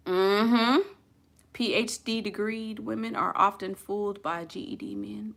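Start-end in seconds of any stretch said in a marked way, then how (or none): 1.5-5.3 whispered. Acted. Confides a saying in a whisper.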